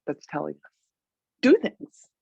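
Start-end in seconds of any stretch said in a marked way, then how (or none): 1.4-2.1 high, loud command; a hammed-up inner drive calling out; urging inner voice. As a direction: bark it like the life force pushing you. Voicing the urging life force.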